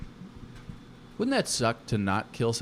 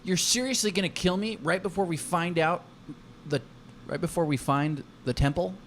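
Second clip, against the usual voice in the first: higher, incredulous player protest; acted disbelief; 7 semitones higher than usual. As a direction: protest the game master's ruling in disbelief. Acts Brent protesting his death.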